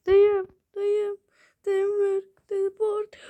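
shrieked begging, high and loud; high shouted plea. Pretend. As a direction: cry it out desperately.

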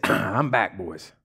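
low, quiet voice with a lightly voiced swagger; a hint of Jesus announcing his return; risen Jesus. Risen Jesus stepping from the tomb, lightly voiced low and cool. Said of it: "Stepped out in all of his glory and said".

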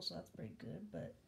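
ordinary talk, nothing marked

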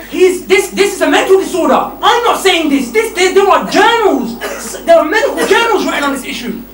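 loud words broken by laughter; laughing while preaching. Real, not acted. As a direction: laugh through the point, still loud.

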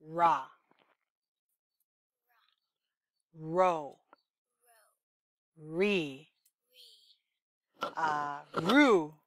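ra, ro, re, ru said one by one; spaced-out model syllables; syllable demo. Shown as a demonstration. Therapist modeling R syllables for the child. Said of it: "So it's gonna sound like this".

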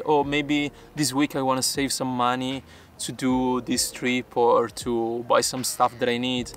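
normal talking voice

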